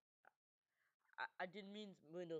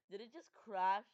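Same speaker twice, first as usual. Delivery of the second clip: alarmed; 4 semitones higher, 15 dB louder than usual. Real, not acted. Shock at the crash.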